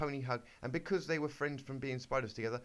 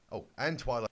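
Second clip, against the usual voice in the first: ordinary talk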